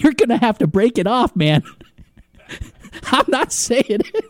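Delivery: high-pitched quote breaking into giggles; Will Ferrell, laughing. Retold or imitated. Host retelling a bar fight from Talladega Nights, laughing helplessly. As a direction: crack up while imitating a defiant movie line.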